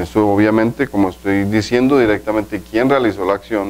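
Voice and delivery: ordinary talk, nothing marked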